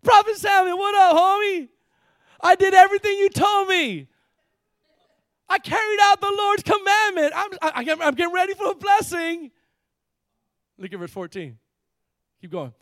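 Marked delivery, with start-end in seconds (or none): 0.0-9.5 high, loud, overeager mock cheer; hammed-up cheerful greeting. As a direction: hail the prophet like a long-lost buddy, shouting high. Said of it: "Saul greeted him cheerfully".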